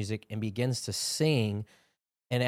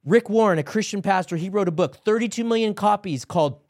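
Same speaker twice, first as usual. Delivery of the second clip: raised, urgent preacher's exhortation; impassioned preaching; 4 semitones higher, 3 dB louder than usual. Real, not acted. Preacher urges men watching to seek God, impassioned. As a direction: preach it with building fervor, pitched up. Exhorts viewers, rising into prayer.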